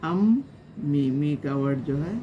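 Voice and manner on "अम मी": अम and मी pulled apart, said slowly; slow syllable split. Shown as a demonstration. Teacher slowly splits अम्मी into syllables for learners. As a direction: stretch each syllable of अम मी apart. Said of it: "पढ़ते वक्त क्या करना चाहिए".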